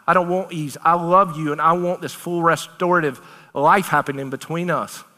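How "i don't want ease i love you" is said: usual speaking voice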